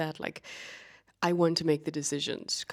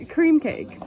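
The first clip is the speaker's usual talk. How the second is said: excited; 9 semitones higher, 10 dB louder than usual. Real, not acted. Excited food naming.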